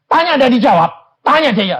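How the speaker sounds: loud, high, fed-up cry; exasperated outburst. Real, not acted. Preacher complaining God won't answer, loud and exasperated. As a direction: throw it out in frustration. Exasperated about unanswered prayer.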